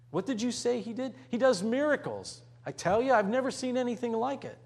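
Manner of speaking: a villager gushing about miracles; excited bystander. Acted. Bystanders gossiping about Jesus, excited and quick. Crowd chatter voiced in sermon.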